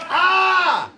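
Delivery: very high, loud scream; acted yell of alarm, long and piercing; full-throated yell. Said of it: "they yell at you like that".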